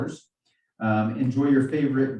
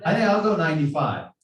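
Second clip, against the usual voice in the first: laughing while bidding, louder; 5 semitones higher, 4 dB louder, faster than usual. Real, not acted. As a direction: giggle through the words. Joking about bidding herself.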